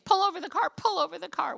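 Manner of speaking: high, loud retold outburst; angry demand. Wife ordering husband to stop the car, high angry demand. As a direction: re-enact a furious demand, pitch jumping up. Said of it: "I was so mad at him".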